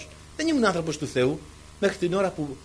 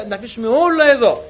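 loud, raised preaching push; rousing exhortation; 5 semitones higher, 8 dB louder than usual. Real, not acted. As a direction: urge them all loudly.

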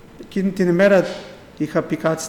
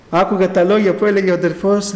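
normal talking voice

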